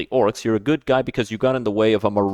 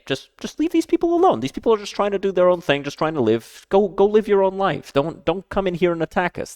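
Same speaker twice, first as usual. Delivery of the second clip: much higher, pleading leave us alone; pleading villager; 10 semitones higher than usual. Retold or imitated. Voicing villagers begging the orc raiders in pleading tones. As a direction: beg the invader to go.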